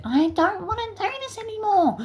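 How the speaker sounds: very high squeaky crybaby whine; mock bawling. Crashed-out kid bawling to quit, mock crying. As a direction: imitate a kid bawling, hammed-up falsetto. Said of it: "he's bawling his eyes out".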